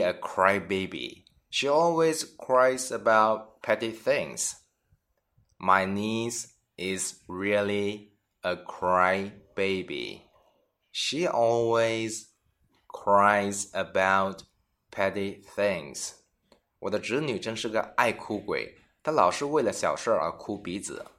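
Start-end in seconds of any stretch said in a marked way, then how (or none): 5.6-16.1 slow, phrase by phrase with pauses; slow teaching read. Shown as a demonstration. Teacher repeats example slowly for students. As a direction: draw each chunk out for learners. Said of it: "而是说爱哭鼻子的人".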